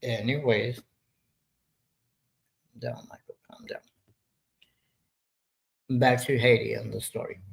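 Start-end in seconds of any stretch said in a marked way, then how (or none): 2.8-3.8 hushed whisper to Michael off to the side. Real, not acted. Whispering to Michael off-mic to calm down. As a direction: whisper him down without the mic catching it.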